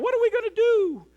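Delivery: high, panicked squeal; hammed-up panicky cry; mock panic. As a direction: act out frantic alarm in a high voice. Panicked heavenly council voiced.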